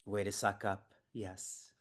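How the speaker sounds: normal talking voice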